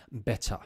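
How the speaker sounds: "better" with a crisp t and no final r; British pronunciation. Shown as a demonstration. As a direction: say "better" as "bet-tah", British style. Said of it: "certain parts of the U K".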